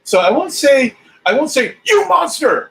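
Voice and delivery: high, loud mock outrage. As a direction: shriek it in fake shock. Mock outrage at double dipper.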